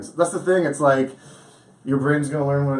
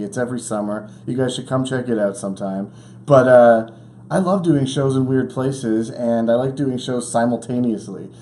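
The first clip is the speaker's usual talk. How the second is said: normal talking voice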